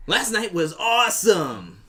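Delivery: loud slow hammy announcement; dramatic reading. Acted. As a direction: proclaim it, over the top. Reading the personal ad aloud.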